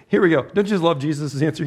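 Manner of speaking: ordinary talk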